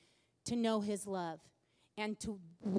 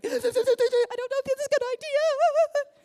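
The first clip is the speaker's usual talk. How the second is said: hammed-up sobbing wail, much higher and louder; retold sobbing; 14 semitones higher, 12 dB louder than usual. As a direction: wail it out through exaggerated sobs, as if breaking down. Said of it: "start sobbing".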